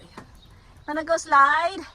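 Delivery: normal talking voice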